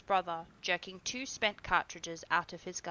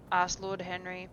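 ordinary delivery, nothing marked

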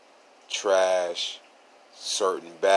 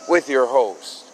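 slow, loud, stretched-out announcer call, higher; hammed-up podcast intro; 4 semitones higher, 9 dB louder, slower than usual. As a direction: announce the show like a big-stage host.

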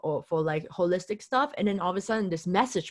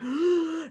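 sharp gasp; 10 semitones higher than usual. Retold or imitated. Mind blown by the message.

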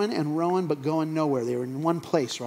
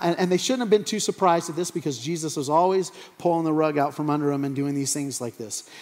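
ordinary talk

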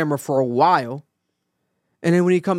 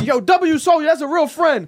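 high, excited crowd hype; retold cheer, pitched way up; hyped cheering; 12 semitones higher, 4 dB louder than usual. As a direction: imitate a chat going wild. Voices the hyped stream chat.

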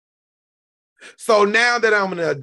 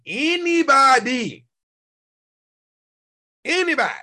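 emphatic shout; 6 semitones higher, 4 dB louder than usual. Real, not acted. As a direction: hammer home that anyone can. Insisting anyone can join.

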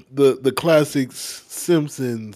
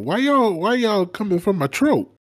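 mock offended; 11 semitones higher, 4 dB louder than usual. Real, not acted. Mock outrage at teasing.

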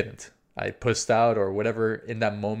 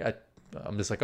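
ordinary talk

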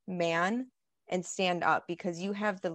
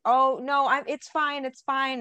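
higher, louder imitation of a man yelling; mock yelling; 6 semitones higher, 6 dB louder than usual. Man on bike trying to get the driver to leave, mock yelling. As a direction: act out his loud, high-pitched brush-off. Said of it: "the guy on the bike is yelling".

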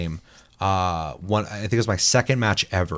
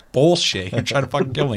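mock angry accusation; 6 semitones higher, 4 dB louder than usual. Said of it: "I'm like".

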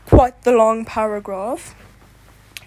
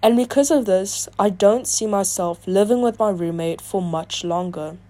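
ordinary talk

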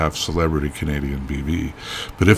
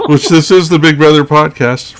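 said through laughter, higher and louder; laughing; 9 semitones higher, 10 dB louder than usual. Real, not acted. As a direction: laugh while pointing out the obvious.

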